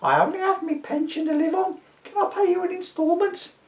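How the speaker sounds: put-on very high pleading old woman voice; old lady pleading. A narrator's reading. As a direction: beg like a frail pensioner.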